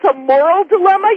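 ordinary talk

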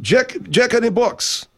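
very high voice, drawn-out words; high excitable character. Acted. Man griping about spicy chicken from Jack in the Box, high-pitched and aggrieved. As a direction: squeal it slowly, building to an indignant shout.

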